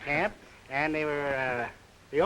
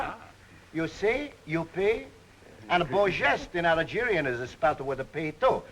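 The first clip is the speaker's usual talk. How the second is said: put-on comic Greek accent; 2 semitones higher than usual. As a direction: play a jolly Greek immigrant pitching the slogan. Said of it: "how about a little example of that dialect you talk of".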